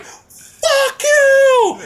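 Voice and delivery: very high, drawn-out mock scream; hammed-up outraged yell; retold yell. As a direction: hurl it at the stage. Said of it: "just yelling at her".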